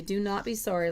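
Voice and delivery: ordinary talk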